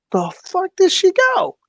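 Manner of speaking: baffled. Retold or imitated. Confused looking for Kelly.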